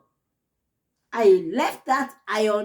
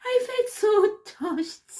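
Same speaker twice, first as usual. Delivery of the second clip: emotional; 9 semitones higher, slower than usual. Real, not acted. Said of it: "that was so emotional".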